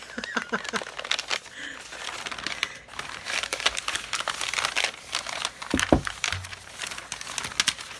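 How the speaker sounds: long soft unvoiced giggle; breathy laugh. Real, not acted. Vlogger showing pottery, long soft giggle. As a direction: laugh quietly and excitedly.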